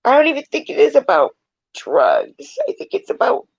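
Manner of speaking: real crying while explaining; tearful. Real, not acted. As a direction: push the words out through tears. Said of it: "It's so sad".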